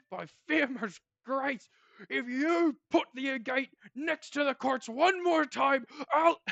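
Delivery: loud, high shouting dwarf; angry dwarf. Acted. Dwarf yelling at a fellow miner. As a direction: yell it like a furious miner. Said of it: "you hear one of the dwarves say".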